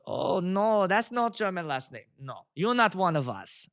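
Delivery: imitating a German friend's accent; put-on German accent, firm refusal. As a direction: deny it in a mock German accent. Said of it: "he's got the German accent".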